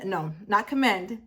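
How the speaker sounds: ordinary talk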